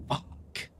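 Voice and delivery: breathless whispered swear; whispered curse. Real, not acted. Player curses a failed roll in a whisper. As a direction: hiss it under your breath.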